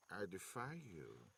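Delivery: put-on low, slow defiance of a frail old man; lightly voiced elderly man, thick antique accent. A narrator's reading. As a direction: defy him quietly and slowly, old and bitter. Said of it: "his accent thick and antique".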